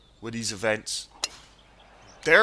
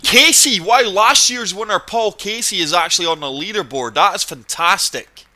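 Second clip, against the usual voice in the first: suddenly louder, higher, thrilled; excited; 6 semitones higher, 7 dB louder than usual. Real, not acted. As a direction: blurt it out with delight. Spots last year's winner on leaderboard.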